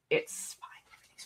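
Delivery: mock-anxious whispered reassurance. Streamer whispering to chat that all is fine. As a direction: hush it like a nervous secret. Joking about mostly planning D&D.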